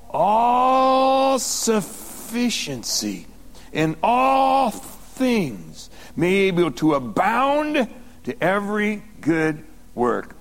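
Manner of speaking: very slow, long-held words; slow emphatic reading. Shown as a demonstration. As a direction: stretch out each phrase of the verse. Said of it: "I'm gonna say that slow".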